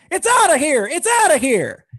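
high excited call said twice; doubled home run call. Retold or imitated. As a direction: repeat the call, climbing high. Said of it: "he'll double up and he'll go".

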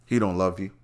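normal talking voice

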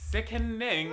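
high loud sickening; camp gush. Retold or imitated. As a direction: squeal the word sickening. Quoting gushing client.

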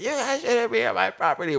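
very high mocking complaint; imitated irate woman. Resident telling workers to leave, high mocking imitation. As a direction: mimic a territorial resident in a high voice.

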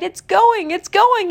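high, rising alarm; urgent cry. A narrator's reading. Pooh watching the bug fly off, high urgent cry. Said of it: "Pooh cried".

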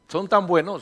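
ordinary talk

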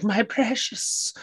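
high, drawn-out covetous creature voice; creature impression. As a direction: squeeze it out high and slow, hoarding treasure. Mimics a treasure hoarder.

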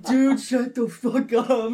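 loud, slow, laughing shut up; laughing mock snap. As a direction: bark it back, half laughing. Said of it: "I'm like".

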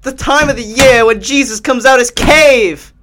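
high, slow, drawn-out announcement; mock-grand proclamation. Joking Easter message to listeners, proclaimed high and slow. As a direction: proclaim it slowly with swelling pitch.

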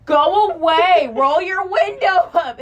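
loud, slow, laughing yell; mock drunk yell. Imitating drunk friend yelling at worried drivers, loud slow mock yell. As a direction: stretch it out, yelling through laughter. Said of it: "they'd be like".

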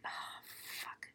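a dread-filled whispered curse under the breath. Retold or imitated. Lori in the cellar, whispering to herself in dread. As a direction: whisper it as the truth sinks in. Lori realizes the body's meaning.

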